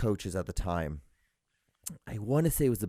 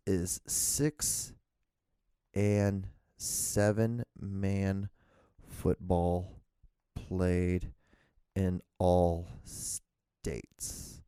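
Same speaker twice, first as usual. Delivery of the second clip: very slow, word by word; halting, stretched-out typing voice; dictating a search; 2 semitones lower, much slower than usual. Real, not acted. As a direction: read it out as you type, long gaps between words. Dictating a search query aloud.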